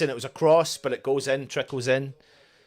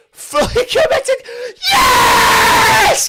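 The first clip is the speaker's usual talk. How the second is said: ecstatic shout; 8 dB louder, much slower than usual. Real, not acted. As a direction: voice leaps high and loud. Realising the goal stands.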